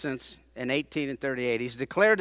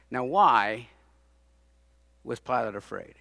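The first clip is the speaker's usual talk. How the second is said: ordinary talk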